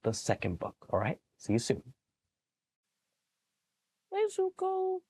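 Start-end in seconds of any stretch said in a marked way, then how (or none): 4.1-5.0 high sing-song hey to the dog; lilting hey Zuko; sing-song dog greeting. Acted. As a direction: call the sleepy dog sweetly. Talking to sleepy dog.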